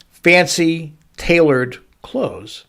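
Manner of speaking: usual speaking voice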